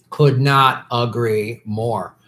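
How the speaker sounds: each word drawn out slowly; slow emphatic agreement. Real, not acted.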